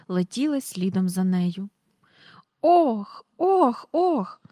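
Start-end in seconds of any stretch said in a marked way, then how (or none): 2.6-4.4 put-on high, thrilled cries of a flying girl; excited calls. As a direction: call out, whirling through the air. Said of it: "гукала Джейн". (високі захоплені вигуки)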